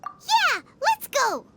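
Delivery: high, bright cheer to set off; rally the group upward; eager. Acted. Paimon urging the group to the hospital, eager.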